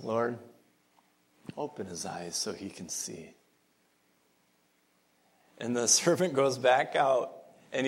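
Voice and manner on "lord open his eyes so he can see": much softer, lower, unhurried plea; calm sighing prayer. Retold or imitated. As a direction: sigh it out softly, calm and unbothered. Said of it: "Elisha just kind of sighs and he says".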